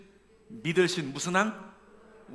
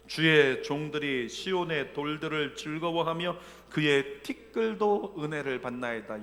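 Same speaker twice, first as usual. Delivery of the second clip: slow solemn Bible reading; scripture reading; 4 dB louder, slower than usual. Acted. Pastor reading Psalm verse to the church, slow solemn reading. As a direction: read the verse with reverence.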